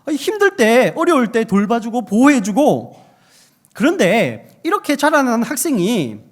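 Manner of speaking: loud, high-pitched pulpit exclamation; forceful preaching. Real, not acted. Preacher tells of a cherished student's duty, forceful preaching. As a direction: press the point home at full volume.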